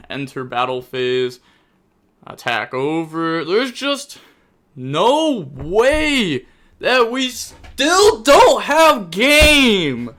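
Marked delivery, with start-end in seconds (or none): 3.4-10.1 high, loud exasperated yelling; loud furious disbelief; angry outburst. Real, not acted. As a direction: blow up in real frustration at the misplay. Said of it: "I'm actually getting so mad".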